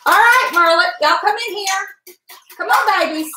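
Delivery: high, loud call to the dogs. Real, not acted. Calling her dogs from the kitchen, high and loud. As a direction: sing out to the babies to come in.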